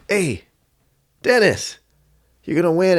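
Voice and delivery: high, loud excited fan's shout; calling out. Retold or imitated. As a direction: holler to your idol from a taxi. Fan calling to fighter across traffic.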